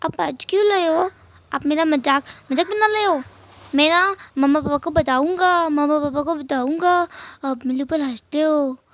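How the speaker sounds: put-on a small boy threatening to tell mummy and papa; sulky little boy. Narrator voices little boy Jingi. (रूठे हुए बच्चे की ऊँची आवाज़)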